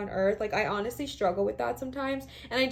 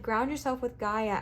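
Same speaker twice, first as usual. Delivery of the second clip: ordinary talk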